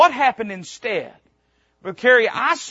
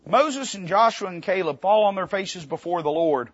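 ordinary talk, nothing marked

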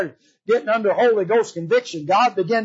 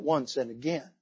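quiet, low restatement; 6 semitones lower, 11 dB quieter than usual. Acted. Preacher explaining the repeated hindering, low and soft. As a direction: drop "once and again" low and soft. Same words repeated softly.

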